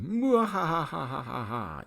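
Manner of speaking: loud, slow hammed-up evil laugh; villain cackle. Mustache-twirling villain gloats over captive, evil cackle. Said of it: "he's going".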